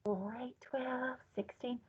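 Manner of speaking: counting in a soft whisper; teacher shows how to whisper the count. Shown as a demonstration. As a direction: breathe the numbers out. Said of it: "I want you to whisper it".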